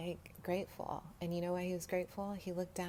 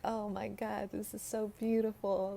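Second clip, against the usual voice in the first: gushing delight; 4 semitones higher, 5 dB louder than usual. Retold or imitated. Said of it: "I'm like".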